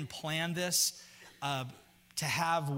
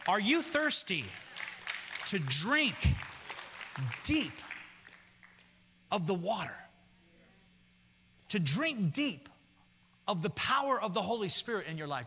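preaching appeal; 3 semitones higher than usual. Acted.

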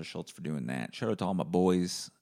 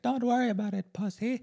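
high put-on mom voice, a bit louder; tough mom; 5 dB louder than usual. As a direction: imitate mom brushing it off. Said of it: "She's like".

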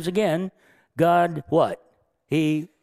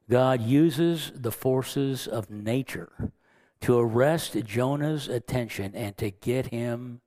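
normal talking voice

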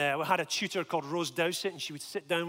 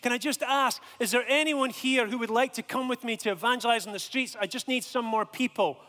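raised, high-pitched call to a crowd; shouted announcement; 5 semitones higher, 3 dB louder than usual. Retold or imitated. Student appealing to a busy mess hall, calling out. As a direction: project over a room of diners. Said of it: "I shouted out and I said".

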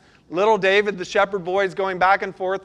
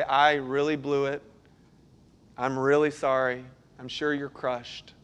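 lower, softer, hushed and sorry; contrite apology; 5 semitones lower, 5 dB quieter than usual. Retold or imitated. Pastor apologizing to a hurt staff member, quiet and contrite. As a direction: say it like you've truly blown it.

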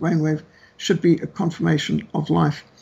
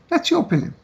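mock dismissive retort, pitched much higher; 11 semitones higher, faster than usual. Doctor brushing off the claim that abortion is murder, high mock dismissal. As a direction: toss it back in a high mock sniff. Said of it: "She said".

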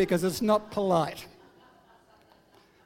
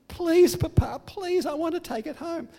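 put-on much higher, eager little girl voice; begging child; 9 semitones higher than usual. Granddaughter begging grandpa to borrow the book, much higher and eager. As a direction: wheedle like an excited child pleading.

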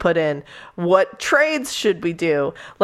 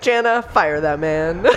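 laughing plea; 3 dB louder than usual. Retold or imitated. Reader urging Jana through a burst of laughter. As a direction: tell her to fire him, then crack up. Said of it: "And I was like".

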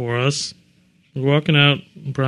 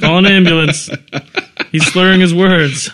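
laughing; 5 semitones higher, 7 dB louder than usual. Real, not acted. Mocking a friend's stumble.

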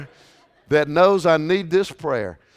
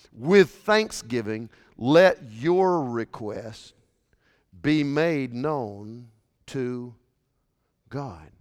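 slow emphatic reading; 6 semitones lower, slower than usual. Acted. Reads the verse word by word.

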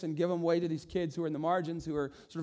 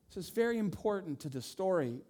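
ordinary talk

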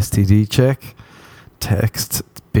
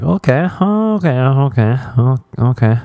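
mock-uneasy string of okays, starting high and sinking; high first okay, then settle back down; hesitant okay; 4 semitones higher than usual. Listener answering an odd flute threat, hesitantly going along. Acts out baffled reply to threat.

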